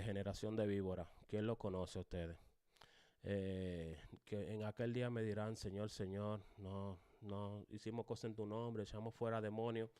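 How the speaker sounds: listless, subdued reading of scripture; lifeless monotone. Shown as a demonstration. Teacher showing how Christ would not have said it, hungry listless monotone. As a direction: drag it out with no emphasis at all. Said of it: "dijo eso en ese tono?".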